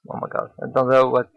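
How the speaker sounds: usual speaking voice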